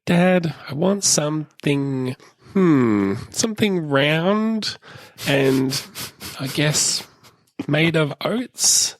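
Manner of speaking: put-on high, slow, coaxing little-girl voice; dad mimics his daughter hinting for an oat biscuit, high and slow; imitating sweet-talking daughter. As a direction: drag out the hints like a child angling for a treat. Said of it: "She sort of, like, talks her way around things".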